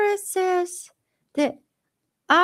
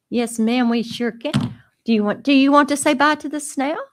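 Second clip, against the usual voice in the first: ordinary talk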